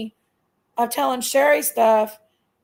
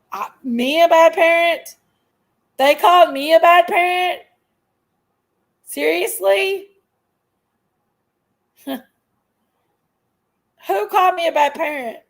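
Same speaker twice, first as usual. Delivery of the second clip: indignant outrage; 4 semitones higher, 6 dB louder than usual. Real, not acted. Outraged at being called bad parent.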